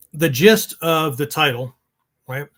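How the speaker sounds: ordinary delivery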